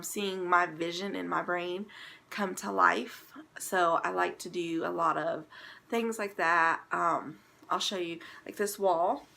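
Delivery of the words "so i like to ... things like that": ordinary talk, nothing marked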